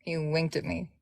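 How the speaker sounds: ordinary delivery, nothing marked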